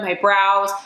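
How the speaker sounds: ordinary talk, nothing marked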